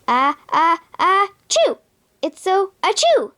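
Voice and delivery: sneeze-broken words, ah-ah-achoo; sneezing. Acted. Prince Achu sneezing at Jasmine. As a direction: let sneezes burst through the line. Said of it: "The genie laughed".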